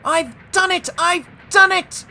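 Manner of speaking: very high, loud jubilant cries; triumphant. A narrator's reading. James topping the hill, puffing triumphantly. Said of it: "he puffed triumphantly".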